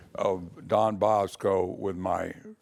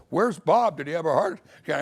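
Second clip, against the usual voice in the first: higher, louder, rushed panic; alarmed; 6 semitones higher, 5 dB louder than usual. Retold or imitated. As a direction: burst in fearing the worst. Bursting into office, boss missing.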